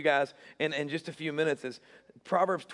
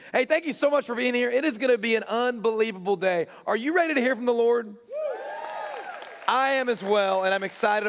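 excited; 7 semitones higher, 4 dB louder than usual. Real, not acted. Pastor hyping up the congregation.

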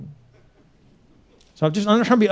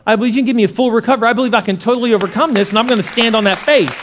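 high, insistent declaration of faith; bold prayer; 6 semitones higher, 5 dB louder than usual. Shown as a demonstration. Believer claims recovery from depression, bold. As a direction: preach it with rising conviction, loud.

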